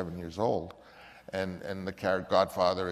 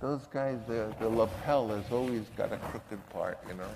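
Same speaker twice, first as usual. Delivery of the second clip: lightly voiced Brando, softer and mumbled; a hint of a street guy musing over his lapel; soft offhand Italian-American mumble; Brando's mumble; 5 dB quieter than usual. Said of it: "I remember him saying".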